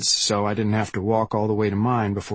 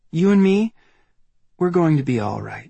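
put-on girl's voice, smiling and warmly reassuring; 6 semitones higher, 3 dB louder than usual. A narrator's reading. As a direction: promise it with a smile. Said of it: "I can hear the smile".

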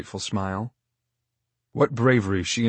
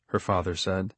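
ordinary delivery

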